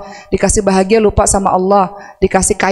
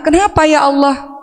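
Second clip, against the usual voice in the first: imitating angry protest; 6 semitones higher than usual. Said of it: "cerita di awal-awal marah, nggak terima".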